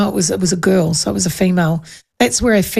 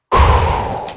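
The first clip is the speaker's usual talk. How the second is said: sigh. Real, not acted. After telling the story.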